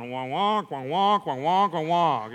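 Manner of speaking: honking, wordless wonk-wonk noises; cartoon grown-up droning in wonks; Charlie Brown wah-wah. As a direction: imitate a muffled trombone teacher. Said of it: "it sounded like Charlie Brown".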